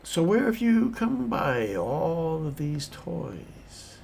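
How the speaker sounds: put-on high, slow old prospector; drawn-out curious old-timer. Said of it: "like an old prospector".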